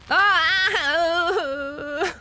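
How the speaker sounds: high, loud drawn-out cry; startled scream. Real, not acted. Streamer reacting to the game, startled wail. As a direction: let out a long high wail.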